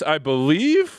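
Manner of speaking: ordinary talk, nothing marked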